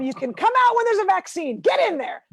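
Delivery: high, loud comic command; shouted order. Acted. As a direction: yell it like an exasperated mother ordering the children back. Mock order to children in womb.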